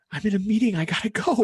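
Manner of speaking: brushing him off from a meeting; Robin hurried. Retold or imitated. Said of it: "she's like".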